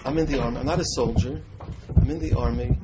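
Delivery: loud, pressing repetition; emphatic insistence. Retold or imitated. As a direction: hammer it home as the mother pleading. Mother's emphatic quoted protest.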